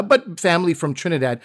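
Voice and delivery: usual speaking voice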